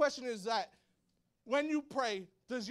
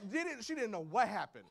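ordinary talk, nothing marked

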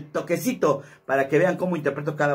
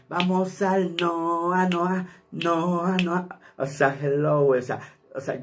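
slow, raised, flamboyant drawl; 3 semitones higher, much slower than usual. Shown as a demonstration. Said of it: "Así más o menos habla".

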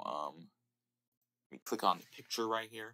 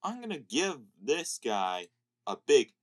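ordinary talk, nothing marked

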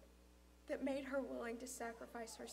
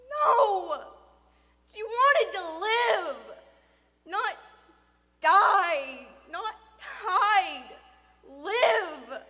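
loud, high, drawn-out words; impassioned cry; 8 semitones higher, 20 dB louder, much slower than usual. Acted. Boy answering sobbing father about friend, impassioned. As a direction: cry out each word with desperate conviction.

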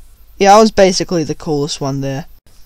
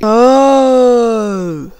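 long drawn-out "oh"; slow, stretched oh of sudden understanding; dawning realisation; 3 semitones higher than usual. Retold or imitated. Passenger seeing flames at the back, drawn-out realisation.

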